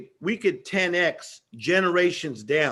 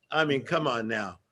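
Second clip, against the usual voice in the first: usual speaking voice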